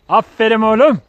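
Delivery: doggy praise. Real, not acted. Owner gushing over his good dog, high and loud.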